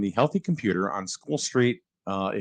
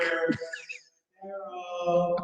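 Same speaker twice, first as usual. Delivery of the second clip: distant echoing call; in a tunnel; 6 semitones higher, 7 dB quieter, slower than usual. Pretend. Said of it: "I sound like I'm in a tunnel right now".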